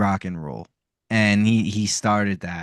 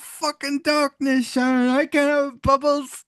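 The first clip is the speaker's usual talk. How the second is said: an aging rock star's high, indignant whine. Retold or imitated. Imitating [name]'s famous rant.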